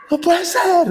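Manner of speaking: high-pitched cry of disbelief, mock; stunned sister. Sister hearing her lost brother on the phone, high mock disbelief. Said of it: "la hermana".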